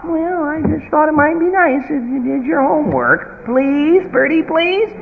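high, meek pleading voice; pleading parent. Retold or imitated. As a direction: beg sweetly, high. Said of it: "and we say".

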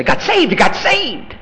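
high, gleeful acted outburst; mock excitement. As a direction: play the thrilled rescued person. Acts out excited rescued person.

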